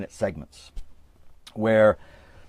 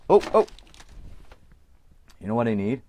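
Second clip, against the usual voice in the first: ordinary delivery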